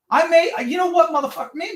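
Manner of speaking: high-pitched, stumbling retort; retold flustered anger. Caller arguing back about his dad, retold flustered anger. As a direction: re-enact losing the thread mid-argument, pitched high.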